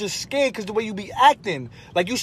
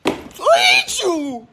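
slow whiny wail; 8 semitones higher, much slower than usual. Acted. Son whining at mother.

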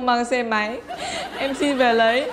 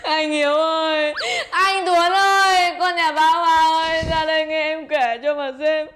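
wailing; 3 semitones higher than usual. Acted. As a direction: wail your woes to the whole neighbourhood. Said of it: "mày gào tên bố mẹ tao lên".

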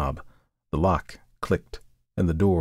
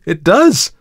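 loud, high refusal, easing toward the end; vehement cry; 5 semitones higher, 8 dB louder than usual. A narrator's reading. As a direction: recoil and refuse at full voice. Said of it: "cried Markheim".

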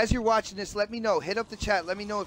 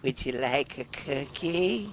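put-on slow, sweetly sinister old hag; creepy hag voice; slower than usual. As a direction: offer the cookie like a witch. Continues hag grandma act.

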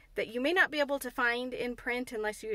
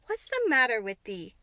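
light Yorkshire dialect, a bit louder; concerned Yorkshire housemaid asking; Yorkshire maid Martha; 6 dB louder than usual. Acted. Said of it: "she asked".

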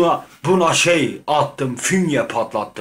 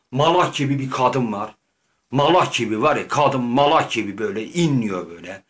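ordinary delivery

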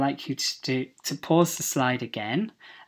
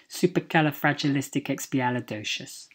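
"Supercalifragilisticexpialidocious" rattled off fast in one run; quick run-through; faster than usual. Shown as a demonstration. Teacher repeating the fun word at speed, quick run-through. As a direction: say "Supercalifragilisticexpialidocious" briskly, syllables flowing together.

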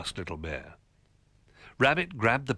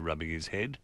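plain reading voice

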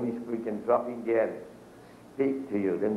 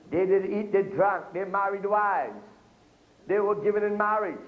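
higher, proclaiming preacher's read; scripture reading; 6 semitones higher than usual. Acted. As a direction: lift the verse up to the church. Reads Luke in preaching voice.

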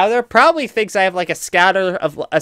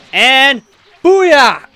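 excited cheer; 7 semitones higher, 7 dB louder than usual. Real, not acted. Landing a big move.

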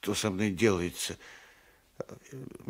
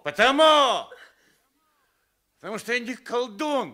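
high, loud furious retort; yelling defensively; angry shout; 10 dB louder than usual. Acted. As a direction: shout him down in outrage. Accused man shouts back.

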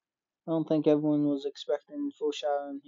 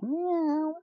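much higher, a cat's meow; 12 semitones higher than usual. Acted. Imitating the fox's cat meow, high.

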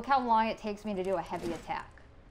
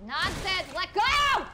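enraged shout, far higher and louder; furious yell; 11 semitones higher, 4 dB louder, slower than usual. Real, not acted. Streamer protesting the block button failed, furious yell. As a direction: scream at the game that you did press it. Streamer raging at game boss.

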